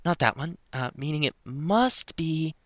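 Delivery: high-pitched character trying keys; high voice, thinking aloud; character muttering to herself. A narrator's reading.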